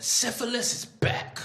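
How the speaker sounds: slow dramatic announcement. Acted. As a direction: announce it like an ominous poster. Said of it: "Ad said".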